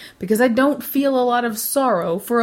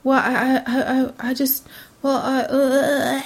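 hammed-up lost for words; mock flustered sputtering; 2 semitones higher than usual. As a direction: imitate a crushed apologizer falling apart. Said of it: "it's like you've just done a Tim Tam slam on 'em and they've fucking dissolved".

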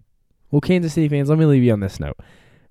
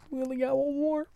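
high, soft, whimpering fake sob; hammed-up mock weeping; mock crying; 9 dB quieter than usual. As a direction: whine it like a pretend crybaby. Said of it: "I might cry today".

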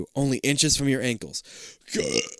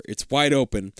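ordinary delivery

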